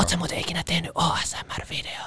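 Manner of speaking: whispered as if for ASMR. Pretend. Host asking about ASMR videos, playful whisper. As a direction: whisper it close to the microphone.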